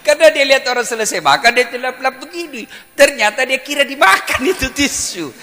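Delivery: high, loud storytelling that builds; full-force comic preaching. Acted. Preacher explaining the tissue blunder to laughing students, loud comic preaching. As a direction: race into the explanation, then shout the verdict.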